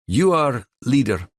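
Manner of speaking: put-on naming him leader in a thick Russian accent. A narrator's reading. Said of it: "in her thick Russian accent".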